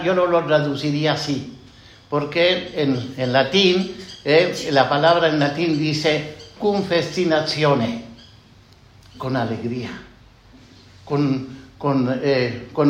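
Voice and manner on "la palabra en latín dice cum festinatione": usual speaking voice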